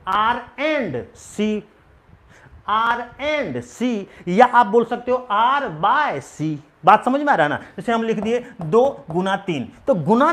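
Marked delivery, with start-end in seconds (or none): 0.1-3.9 R and C drawn out very slowly; slow teacher dictation. Shown as a demonstration. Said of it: "इसको क्या पढ़ेंगे?". 4.3-6.6 slow demo reading. Shown as a demonstration. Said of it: "इसको क्या पढ़ेंगे?".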